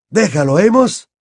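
ordinary talk, nothing marked

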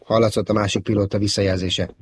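ordinary delivery, nothing marked

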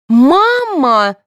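high, loud, long-drawn cry; shouting. A narrator's reading. Daughter demanding that mother obey police, shouting. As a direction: yell for mother, voice right up. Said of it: "закричала Соня".

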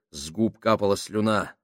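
plain reading voice

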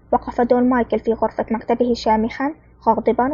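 plain reading voice

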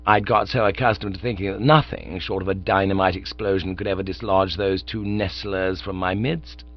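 ordinary talk, nothing marked

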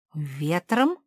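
mildly puzzled, rising question; bewildered. A narrator's reading. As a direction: echo it in confusion. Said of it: "растерянно повторила Зефирка". (растерянно)

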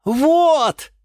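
very high, loud triumphant cry; exclaiming. A narrator's reading. Geranium seizes on the encouragement, triumphant cry. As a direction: burst out, vindicated. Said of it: "воскликнула герань Антонина".

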